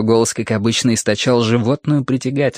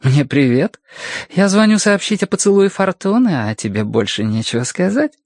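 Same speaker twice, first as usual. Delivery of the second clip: higher female voice, mock-offended; flirtatious woman caller; 7 semitones higher than usual. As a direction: tease him with playful reproach. Sonya's teasing phone voice.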